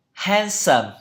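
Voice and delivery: handsome with an American A leaning toward E; stressed, drawn-out "hen-sum" handsome; American pronunciation. Shown as a demonstration. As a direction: say handsome the American way, first vowel close to "hen". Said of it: "âm này hơi thiên vào âm E một chút trong Anh Mỹ".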